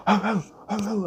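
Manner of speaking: high yelping "oh, oh, oh" for the barking dog; mock dog yelps, way up high; dog bark imitation. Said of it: "my dog was like".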